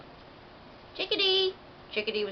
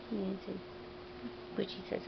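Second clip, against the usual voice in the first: ordinary delivery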